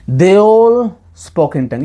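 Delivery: very slow, with all stressed; slow stressed quote. Shown as a demonstration. Preacher sums up the verse for the congregation, slow and stressed. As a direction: spell out they ALL spoke in tongue. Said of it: "ये सभी अन्य भाषा में बोले".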